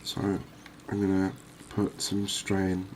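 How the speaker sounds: normal talking voice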